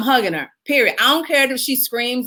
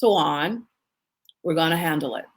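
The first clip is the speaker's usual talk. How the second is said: calm lawyer; 6 semitones lower, 5 dB quieter than usual. Retold or imitated. The calm attorney soothing his worked-up client, lower and softer. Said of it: "he will go".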